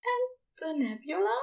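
tender high angel prompting; soft prompt. Acted. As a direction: coax him on softly. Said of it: "Aziraphale prompts softly".

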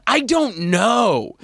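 slow, high hesitant; drawn-out unsure. Retold or imitated. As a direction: drag it out unsure. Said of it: "I'm like".

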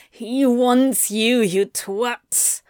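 slow, exasperated growl; forceful, drawn-out reproach. A narrator's reading. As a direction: growl it at someone being dense. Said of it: "Anthony growled".

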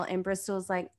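normal talking voice

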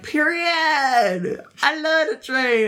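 high, drawn-out diva voice; high diva voice greeting the arriving train; imitating Rolling Ray. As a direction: sing it out with diva flair. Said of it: "he's like".

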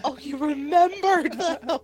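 high, drawn-out gushing with a laugh; retold delight. Retells delight at child remembering puppets.

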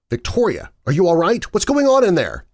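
urgent. A narrator's reading. Marcus calls through a locked door to Victoria, urgent and loud. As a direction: call through the door, growing alarmed and loud. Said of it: "I asked urgently".

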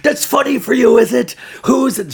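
high, loud mock outrage; angry girlfriend. Girlfriend to laughing boyfriend, angry shrill accusation. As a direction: imitate her shouting accusingly. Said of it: "she's so angry though".

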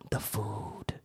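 "The food" breathed in a low, secret whisper. Shown as a demonstration. Food remark then whispered echo.

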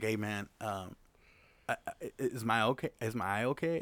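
soft, hesitant asking; timid mock worry, hushed and halting; sheepish imitated question. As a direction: ask it quietly, stumbling at the start. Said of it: "he's like".

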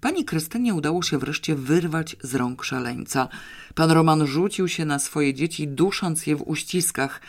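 usual speaking voice

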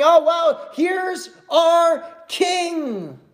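high, stretched-out cry of acclaim; jubilant proclaiming. Acted. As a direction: proclaim it like an awed, cheering crowd. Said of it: "they're proclaiming and praising Christ".

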